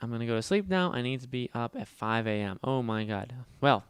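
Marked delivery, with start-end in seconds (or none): none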